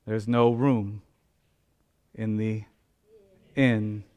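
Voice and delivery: slow, soft and drawn out with pauses; softly quoted refusal. Retold or imitated. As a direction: let the old words "There's no room in the inn" fall gently. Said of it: "utters those words".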